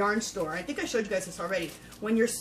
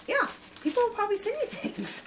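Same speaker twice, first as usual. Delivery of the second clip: laughing; faster than usual. Real, not acted. Jokes about her big mouth.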